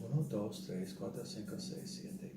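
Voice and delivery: counting fading out. Retold or imitated. Counting days for the border guard, fading to a whisper.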